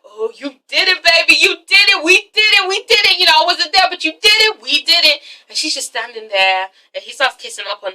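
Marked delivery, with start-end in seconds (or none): 0.0-5.2 excited cheer. Retold or imitated. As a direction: celebrate like the win is his. Terrence celebrates the good news.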